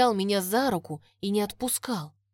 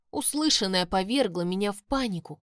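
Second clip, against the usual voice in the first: usual speaking voice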